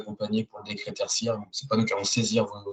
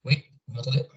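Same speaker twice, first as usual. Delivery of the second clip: sounding far from the mic; distant mic; faster than usual. Real, not acted. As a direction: speak from across the room. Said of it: "On vous entend un petit peu loin".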